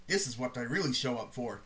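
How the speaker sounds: low, hushed inner voice of God; a deep quiet whisper in his head; low ominous voice in his head. Retold or imitated.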